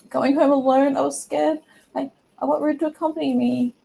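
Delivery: high, put-on girl voice; hammed-up high-pitched plea; pleading girl. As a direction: act scared and plead for company. Voices crying girl asking for company.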